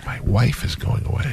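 hushed, half-whispered secret; low confidential voice. Pretend. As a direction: let it slip out under your breath. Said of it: "I gotta say this low".

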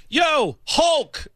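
high-pitched hail to get attention; calling out. Retold or imitated. Fan hailing a passing wrestler to turn his head, calling out. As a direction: imitate a fan calling to a star. Retold kid calls out to wrestler.

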